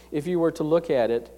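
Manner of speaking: ordinary delivery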